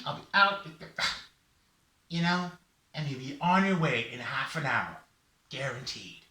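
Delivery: lively, lightly voiced helper; confident Raven. Raven promises a quick tooth fix, lively confident assurance. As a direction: lay out the plan with salesman-like assurance. Raven lays out his plan.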